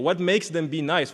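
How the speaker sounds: ordinary delivery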